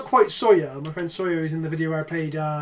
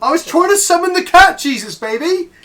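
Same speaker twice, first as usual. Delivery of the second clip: high, shouted self-defence; loud protest; 11 semitones higher, 11 dB louder than usual. Real, not acted. Streamer answering partner's noise complaint, loud high protest. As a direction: yell back in exaggerated protest.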